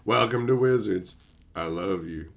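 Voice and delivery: deadpan low parody of the Idiocracy line; Idiocracy quote voice. Retold or imitated. Quoting Idiocracy to chat in a low dopey voice. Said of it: "Aldini making the Idiocracy reference".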